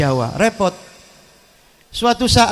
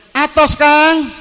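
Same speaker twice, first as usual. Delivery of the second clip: high-pitched yell to a workmate; Sundanese driver's shout; 8 semitones higher, 4 dB louder, much slower than usual. Acted. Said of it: "supirnya teriak lagi". (teriakan melengking supir Sunda)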